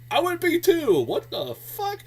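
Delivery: high-pitched, startled disbelief; startled reaction. Retold or imitated. Reviewer acting out the startled man on a phone call, startled. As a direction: blurt it out high, taken aback. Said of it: "he's startled by that".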